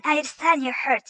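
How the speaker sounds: ordinary delivery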